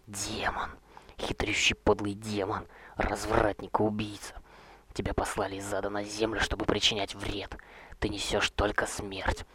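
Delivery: slow venomous whispered curses; hissing whisper. Acted. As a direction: hiss out the accusations. Roger hisses curses again.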